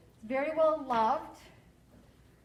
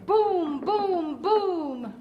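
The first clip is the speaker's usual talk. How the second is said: loud, separate booms, each falling; booming thunder; 4 semitones higher, 6 dB louder, much slower than usual. A narrator's reading. Teacher performs Mister Brown's thunder for kids, loud booming. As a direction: boom it out like thunderclaps. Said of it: "Mister Brown makes thunder".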